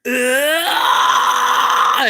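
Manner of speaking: high, loud pushed scream; one sustained raspy scream, high and supported; diaphragm-backed scream. Shown as a demonstration. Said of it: "that kind of a diaphragm tone".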